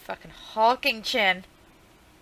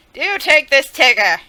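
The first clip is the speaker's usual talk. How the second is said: dramatic declaration; 6 semitones higher, 14 dB louder, slower than usual. Acted. Proclaiming loudly over drawing.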